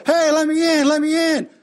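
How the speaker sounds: mock shout to be let in; husband banging on the bedroom door, calling out loudly; calling through door.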